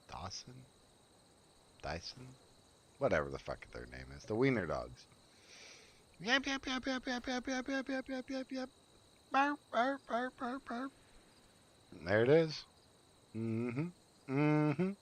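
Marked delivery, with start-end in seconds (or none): 6.2-10.9 high tiny yapping barks; yappy dog imitation. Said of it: "Them yappy dogs?".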